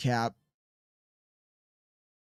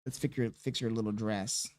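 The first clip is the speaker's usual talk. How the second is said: much softer and lower, tender; soft murmur; 6 semitones lower, 10 dB quieter than usual. Real, not acted. Dad fixing baby's dress, speaking in a low murmur.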